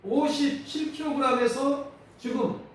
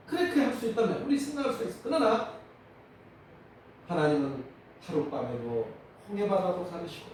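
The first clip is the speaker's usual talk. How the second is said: ordinary delivery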